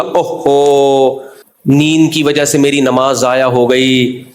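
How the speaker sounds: slow, drawn-out mock lament; mimicking a theatrical moan of self-pity; put-on dismay. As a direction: act out exaggerated regret, dragging the words. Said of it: "اوفو کر کے acting نہ کریں".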